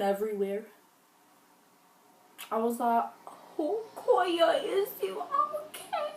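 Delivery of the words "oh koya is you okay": squeaky high, slowed worried question. Retold or imitated. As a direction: ask in a tiny high voice. Quoting herself to bleeding brother.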